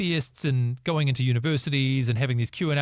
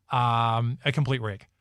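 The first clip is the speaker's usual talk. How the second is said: ordinary talk, nothing marked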